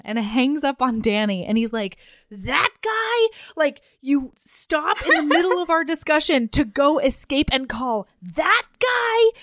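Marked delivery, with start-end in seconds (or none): 2.3-9.3 high, incredulous mock outrage; pitched-up, exasperated boyfriend imitation; retold pissed off. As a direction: act out jealous indignation. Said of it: "He's so pissed".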